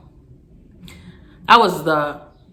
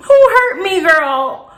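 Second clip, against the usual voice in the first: incredulous retort; 11 semitones higher, 6 dB louder, slower than usual. Real, not acted. Retorting friend's question, incredulous.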